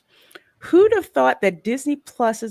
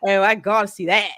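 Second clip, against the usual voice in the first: put-on Australian accent; 5 dB louder, faster than usual. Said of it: "I know my Australian accent sucks".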